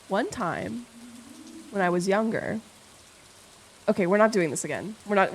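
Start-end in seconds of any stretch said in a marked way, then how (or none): none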